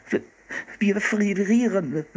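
high, slow, stuttering shiver; shivering with cold. A narrator's reading. Freezing boy asking the hunter for help, shivering. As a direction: stammer through chattering, drawn-out words. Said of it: "Wir brauchen wirklich Hilfe".

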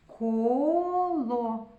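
Коло with the first о held very long; drawn-out first О. Shown as a demonstration.

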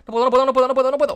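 high, loud panic; frantic, can't get out; panicked. Real, not acted. Panicked, trapped underwater.

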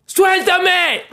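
high-pitched cry to let go; re-enacted yell. Child yelling at mom while being hit, high-pitched yell. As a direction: yell it high, as your younger self. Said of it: "Yo le grité así".

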